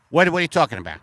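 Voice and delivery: ordinary talk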